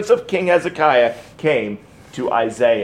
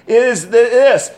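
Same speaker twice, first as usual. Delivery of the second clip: preaching at full force; 6 semitones higher than usual. Real, not acted. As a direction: hit the climax hard, voice up high. Preacher drives home dying baby.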